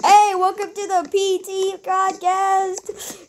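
mock podcast intro. Boy opens an imaginary Spotify podcast, mock host announcing. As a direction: slowly announce the show like a host.

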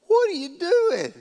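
high-pitched, appalled outcry; mock-horrified exclamation; shocked protest. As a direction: shoot the pitch way up in disbelief. Jeroboam sees his coat torn.

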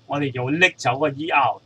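ordinary delivery

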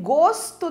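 GOSTO with a closed O. Shown as a demonstration. Teacher giving a flavour example, closed O in gosto. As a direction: say gosto slowly with the rounded closed vowel. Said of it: "som fechado do O".

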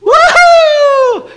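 very high, loud cheer; jubilant whoop. Acted. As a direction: whoop at the top of your voice. Celebrating a cancelled loan.

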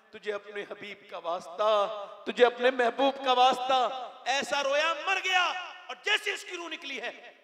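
high, loud, tearful sermon climax; wailing preacher pleading for mercy, slowed and loud; full-force preaching. Acted. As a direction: cry out to God at the top of the voice. Climax of sermon, weeping plea.

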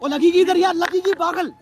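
pleading shout. Real, not acted. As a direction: yell at them to stop, upset. Pleading loudly to stop the fight. (लड़ाई रोकने के लिए ऊँची आवाज़ में गुहार)

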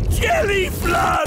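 gasp, then very high cry; horrified shout. Acted. King realises the flood is coming, horrified shout. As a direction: gasp and shriek the alarm. King finally understands the warning.

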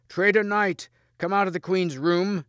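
high, loud accusing yell; shouting. A narrator's reading. Agravain beating on the queen's door, shouting. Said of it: "shouted Sir Agravain".

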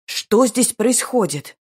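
furious demand. A narrator's reading. Brother catches his sister with the duke, furious. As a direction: demand answers, outraged. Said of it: "Яростно потребовал ответов брат".

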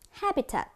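"Habitat", high first syllable then drop; stress on first syllable. Shown as a demonstration. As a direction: punch the HA in "Habitat.". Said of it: "âm đầu tiên".

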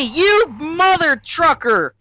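slow angry outburst. Real, not acted.

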